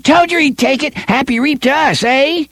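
put-on high, gloating boy voice; jeering. First boy gloating to his mates, high and jeering. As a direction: crow over the bet he won. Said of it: "the first boy jeered".

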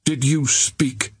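cold, slow and aloof; ice-cold haughty question; icily. A narrator's reading.

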